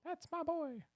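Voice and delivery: very high silly squeak; squeaky high voice. Pretend. Silly shouted catchphrase.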